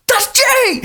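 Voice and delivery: high-pitched mock shout of recognition; excited recognition. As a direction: cry it out as if just realising. Retold shared realisation of the impression.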